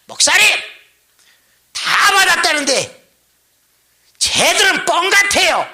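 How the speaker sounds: high, loud mock complaint; loud accusing protest. Blunt deacon challenging the pastor in front of everyone, loud protest. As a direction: call out the objection, imitating a blunt deacon.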